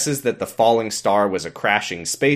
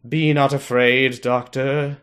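slow, raised, portentous warning; solemn proclamation; 4 semitones higher, slower than usual. Retold or imitated. Retelling Leela's line to the co-host, solemn and slow. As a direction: intone it like scripture.

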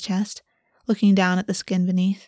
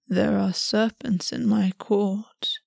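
slow, cold Raven Prince warning; much slower than usual. A narrator's reading. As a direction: let the words come slow and chilling. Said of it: "something cold in his voice".

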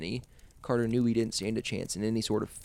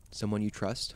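ordinary delivery, nothing marked

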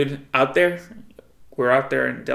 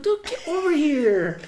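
high, slow, drawn-out holler; calling the dog; 13 semitones higher, slower than usual. Retold or imitated. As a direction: stretch the call out to a far-off dog. Retold calling the barking dog back.

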